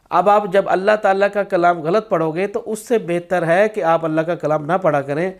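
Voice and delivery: ordinary talk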